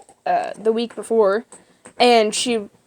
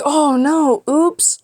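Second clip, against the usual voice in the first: high, loud fake-innocent oops; singled-out mock "oh no", loud and high; mock dismay; 6 semitones higher, 6 dB louder, much slower than usual. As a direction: play innocent, hammed up and higher. Kids feign innocence over knocked chairs.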